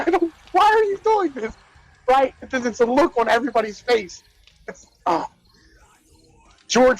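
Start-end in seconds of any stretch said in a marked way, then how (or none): 0.0-1.5 high, pleading whine of disbelief; imitated roastee, much higher and bewildered; baffled Bob Saget. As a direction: squeak out a helpless why like the roast target.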